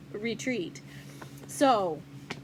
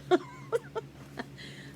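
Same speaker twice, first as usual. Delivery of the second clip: breathy giggling laugh; real laugh at herself; high laugh; 10 semitones higher, 4 dB quieter than usual. Real, not acted. Laughing at her own attempt.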